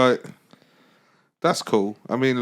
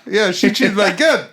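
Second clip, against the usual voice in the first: bad South African accent; 7 semitones higher, 3 dB louder than usual. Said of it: "Do South African then".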